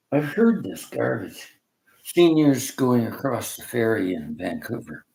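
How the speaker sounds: ordinary talk, nothing marked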